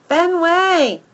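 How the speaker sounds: high, loud shout falling away; shouting his name. A narrator's reading. Second girl shouting for the dog. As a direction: call out the name as she chases. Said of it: "she shouts".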